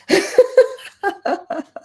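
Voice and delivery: high, loud burst of laughter; hearty laugh. Real, not acted. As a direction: crack up at your own story. Laughing at her own story.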